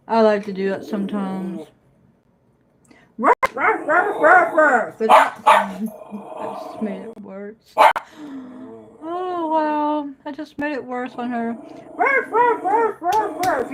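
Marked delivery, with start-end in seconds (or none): 3.2-4.9 high yappy barking at the dog; playful barks mimicking the dog; mock dog barks. Imitating dog barking back. 12.0-13.7 very high yappy barks; teasing puppy barks; mock dog barks. Imitating dog barking back.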